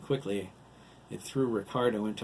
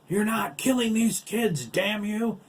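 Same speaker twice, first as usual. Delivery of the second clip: high, loud furious shout; raging; 12 semitones higher, 7 dB louder than usual. A narrator's reading. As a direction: rage at the villain in defiance. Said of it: "Lyle raged".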